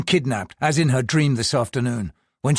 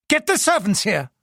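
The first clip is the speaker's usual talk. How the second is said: angry order; 8 semitones higher than usual. Acted. Husband in rage.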